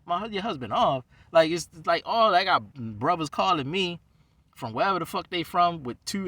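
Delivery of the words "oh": ordinary talk, nothing marked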